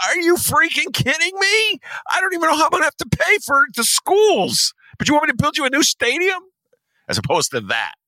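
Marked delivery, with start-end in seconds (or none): 0.1-6.4 very high, incredulous yelling; mock outrage. Mayor refusing to build a new stadium, mock outraged squawk. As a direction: explode in high-pitched disbelief.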